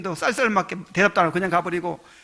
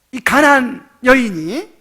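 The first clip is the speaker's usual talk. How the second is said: ordinary talk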